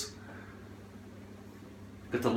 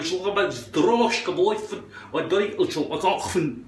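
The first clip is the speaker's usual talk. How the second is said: thick guttural Scottish gibberish, lilting higher; natural Scots babble; 3 semitones higher than usual. Shown as a demonstration. Explaining to the listener in their own tongue, lilting Scots gibberish. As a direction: babble on comfortably in impenetrable Scots. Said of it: "more comfortable if I could explain".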